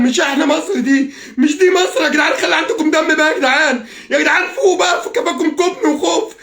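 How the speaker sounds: high, worked-up voice begging to be heard; real anguished appeal, pitched high; desperate pleading. Real, not acted. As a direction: plead with them as if at breaking point. Desperately pleading with his countrymen.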